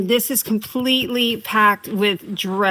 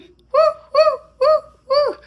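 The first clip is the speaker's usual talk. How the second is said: playful high woo woo; high hoots; 4 dB louder than usual. Pretend. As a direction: hoot it up high.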